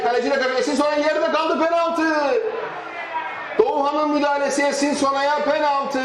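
high shouted penalty call; screaming football commentator. Real, not acted. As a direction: yell penalty at the top of your voice.

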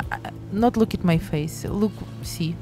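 usual speaking voice

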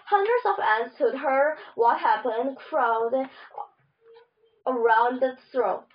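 ordinary delivery

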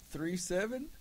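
puzzled echo. Retold or imitated. Everyone answers a catchphrase they've never heard, puzzled. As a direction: imitate a baffled group, rising into a question. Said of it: "Everyone's like".

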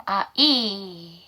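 아, 이 very slowly, the second vowel held long; diphthong spelled out. Shown as a demonstration. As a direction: stretch 아 into a long 이. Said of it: "여기서 이중 모음".

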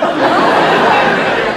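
big loud laugh; loud burst of laughter. Real, not acted. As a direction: let a huge laugh rip.

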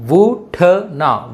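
very slow, drawn-out ఉట్నా; syllable by syllable. Shown as a demonstration. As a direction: sound out ఉట్నా for learners. Teacher sounds out word once more.

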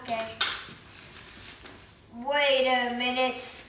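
ordinary delivery

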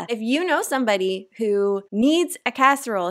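ordinary talk, nothing marked

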